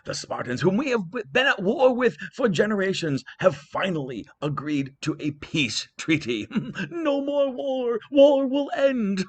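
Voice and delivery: a father overjoyed at peace; King Priam overjoyed. Acted. Priam to his son, high joyous news. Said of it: "and he said".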